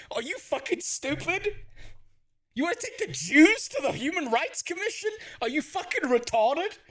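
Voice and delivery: exasperated outburst. Real, not acted. Ranting at politicians' decision.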